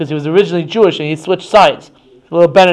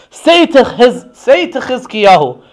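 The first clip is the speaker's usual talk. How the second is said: mildly scornful messenger's call; taunting envoy; 5 semitones higher, slower than usual. Acted. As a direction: call it out as a challenge to the listeners. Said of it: "comes and he says to them".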